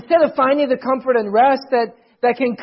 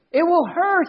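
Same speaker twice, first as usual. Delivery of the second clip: mock moan; 7 semitones higher than usual. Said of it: "he moaned".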